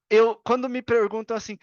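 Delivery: ordinary delivery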